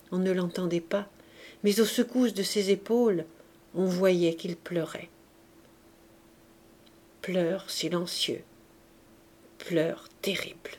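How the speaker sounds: ordinary delivery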